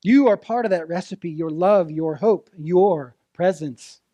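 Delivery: ordinary talk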